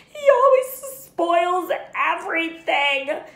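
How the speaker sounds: high, loud fake wailing; hammed-up fake sob; pretend sobbing yell. A narrator's reading. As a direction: spoilt boy fake-crying to get his way. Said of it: "Dudley yelled between huge pretend sobs".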